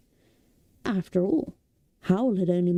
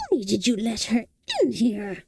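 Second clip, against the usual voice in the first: a hint of a sulky fire demon; lightly voiced fire demon; 3 semitones higher than usual. A narrator's reading. Fire demon Calcifer blames Michael, lightly voiced sulk. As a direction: grumble it at Michael.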